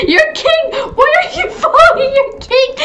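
very high, giggly mock indignation; high, playful royal protest; squealing king. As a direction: squeal at your followers through laughter. Followed by other players.

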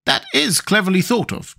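high-pitched character voice; Nick Chopper's voice. A narrator's reading. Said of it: "declared Nick Chopper".

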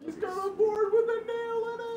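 excited high shout. Real, not acted. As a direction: squeal it out in delight. Excited shout about a weapon.

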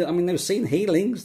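usual speaking voice

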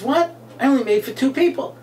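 high-pitched domestic protest; indignant wife. Acted. Joke wife to husband about an extra dinner guest, high and indignant. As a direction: jump up high in dismayed protest.

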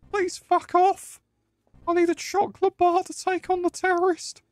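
squeaky falsetto lady; lady's voice. Pretend. Performing for chat during the game, mock lady's falsetto. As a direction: put on a very high, hammed-up woman's voice. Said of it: "you want me to say in a lady's voice".

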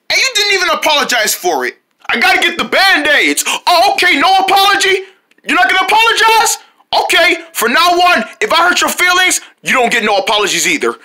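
high, indignant fox voice demanding an apology; offended fox. Acted. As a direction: squeak out hurt pride at the friend. Offended fox quarrels with friend.

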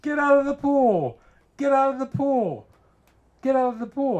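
high, loud imitated scream; frantic screaming. Friend's daughter to swimmer in the pool, screaming in alarm. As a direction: yell an urgent warning at full pitch. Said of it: "came out and started screaming".

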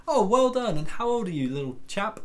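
high, lilting "aren't you clever" opening that settles down; patronising praise. Retold or imitated. Pub-goers congratulating a ten-year-old performer, patronisingly sweet. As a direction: coo over a little kid, imitating a kindly grown-up.

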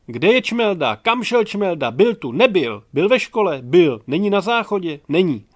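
loud, rapid-fire questions; excited questions. Acted. Classmates hunting for missing Čmelda, excited. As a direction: pepper them with questions.

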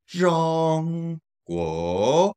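long flat high ZHONG, rising GUO; tone demonstration. Shown as a demonstration. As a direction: sustain a high ZHONG, then let GUO climb. Teacher demonstrates word for China.

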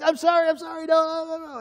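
high pleading sorry; hammed-up cowed bully pleading; mock whimpering apology. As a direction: beg like a scared bully. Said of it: "he's like".

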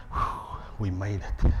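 slow, low mock relief; relieved sigh. A parent arriving at church late, relieved and slow. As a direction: sigh it out like you barely made it. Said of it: "it was more a thing of".

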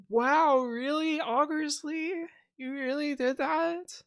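very high, slow fake wonder; high mock amazement. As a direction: gush back in high surprise. Acting an impressed listener.

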